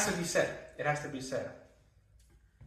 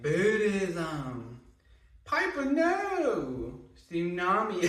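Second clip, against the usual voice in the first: drawn-out, over-the-top Southern drawl; Parker Posey, exaggerated Southern accent; 4 semitones higher, much slower than usual. Imitating an actress's clips for viewers, exaggerated Southern accent. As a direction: stretch every word in a hammed-up Southern accent, swooping high. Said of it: "speaks with a really exaggerated Southern accent".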